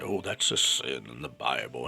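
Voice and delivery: low, slow preachy pastor voice; pastoral tone. Retold or imitated. Mocking his former religious self, low slow pastoral voice. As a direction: intone it like a sanctimonious old pastor. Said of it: "I would talk like this here with this tone".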